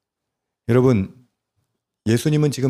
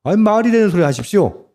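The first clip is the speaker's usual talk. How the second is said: Peter scolding; 8 semitones higher than usual. Retold or imitated. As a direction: snap back at Jesus in disbelief. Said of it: "베드로가 예수님을 야단쳤어요".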